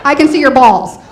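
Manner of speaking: cracking up on the flubbed line; flubbed punchline laughing. Real, not acted. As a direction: breaks up mid-joke on the wrong word balls. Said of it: "Wait, wait, no".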